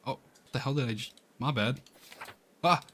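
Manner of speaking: normal talking voice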